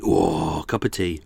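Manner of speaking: normal talking voice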